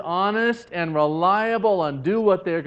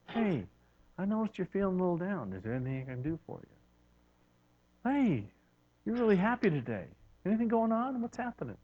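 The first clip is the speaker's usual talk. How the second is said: soft, hushed caring check-in; gentle concern; 2 semitones higher, 8 dB quieter than usual. Acted. Merciful friend noticing someone's mood, asking softly. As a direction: lean in and ask kindly.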